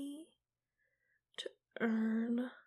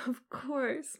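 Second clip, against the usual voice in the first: laughing it out louder; 6 dB louder than usual. Acted. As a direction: giggle through the words.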